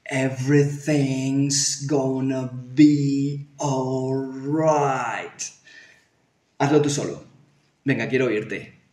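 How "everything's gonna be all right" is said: stretched-out, very slow speech; slow motion. Shown as a demonstration. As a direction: drag every syllable out. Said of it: "otra vez".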